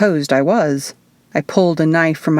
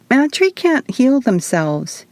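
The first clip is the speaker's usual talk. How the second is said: higher, slower, light witch voice; gentle reminder; 7 semitones higher, slower than usual. A narrator's reading. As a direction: remind her softly. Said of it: "Izzy quietly reminded her".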